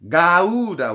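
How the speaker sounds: "Gouda" with a strong G, long drawn-out middle. Shown as a demonstration. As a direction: stretch Gouda slowly after a strong G. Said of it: "with a strong G".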